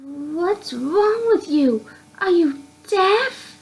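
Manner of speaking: high, slow, exasperated pout; bratty girl voice. Acted. The spirit girl scolds the confused narrator, high and pouting. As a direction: sulk at him like a spoiled girl. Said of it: "pouts in a bad mood".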